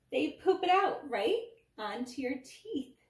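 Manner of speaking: high, loud, sure-of-it outburst; confident yell. A narrator's reading. Dentist answering for reluctant Danny, yelling confidently. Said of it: "Doctor Dent confidently yelled".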